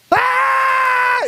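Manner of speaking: put-on sudden high shrieking child scream; a toddler's piercing wail; child's scream. Daughter waking again, high loud shriek.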